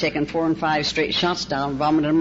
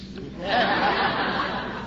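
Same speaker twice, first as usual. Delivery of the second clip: short laugh; 5 dB quieter than usual. Real, not acted. Laughs at her phone lie.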